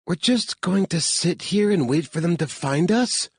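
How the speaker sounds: high, incredulous woman's voice; alarmed heroine. A narrator's reading. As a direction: question the plan, pitched well up. Claire's figure in narration.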